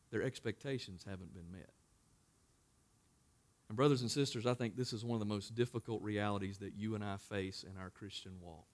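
normal talking voice

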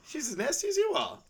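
high cartoon donkey impression; high squeaky Donkey voice. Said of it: "She goes".

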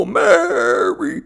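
high Santa laugh and greeting. Acted. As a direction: call it out like Santa.

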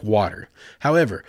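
ordinary talk

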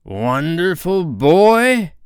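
very slow, high, loud question; drawn-out, climactic pirate query; probing captain. A narrator's reading. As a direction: build the guess to a ringing rise. Hook's last climactic guess.

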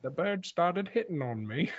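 hushed, lightly Cockney Caine voice; a hint of Michael Caine, murmured; faint London Caine voice; Michael Caine impression. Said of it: "your Michael Caine impression".

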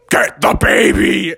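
slow, rough gorilla shout; drawn-out beastly command; big gorilla. Acted. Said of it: "a big gorilla was like".

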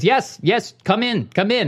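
high-pitched imitated Indian-sounding accent. Ray inviting the caller inside, imitated Indian-sounding accent. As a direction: insistently wave them in, imitating an Indian accent. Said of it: "Why does he sound Indian?".

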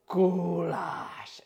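"goulash" drawn out long; slow, lingering "goulash"; stretched-out word. Shown as a demonstration. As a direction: stretch both syllables of "goulash" right out. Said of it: "just stretch the word out".

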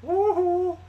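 high, put-on creepy half-German shopkeeper voice; hammed-up creepy German-ish accent luring a customer. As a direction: lure him into the shop, sleazy. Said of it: "What's that accent supposed to be?".

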